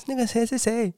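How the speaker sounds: high, sugary phone voice calling his name; sweet saleswoman caller. Retold or imitated. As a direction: coax him like a pushy recruiter. (高声甜腻的女推销员)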